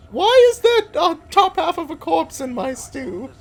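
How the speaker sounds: high, loud shocked aristocrat; snooty aristocrat. Retold or imitated. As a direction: squeal it like an appalled noble. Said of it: "fourteen hundreds aristocrat with a wig is like".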